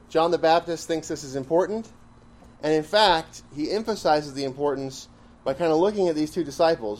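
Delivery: usual speaking voice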